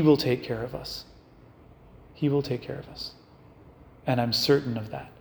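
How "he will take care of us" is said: repeated much softer, almost to herself; a soft echo of faith. Retold or imitated. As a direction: let it sink in, hushed. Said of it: "Mary's heart is constantly saying".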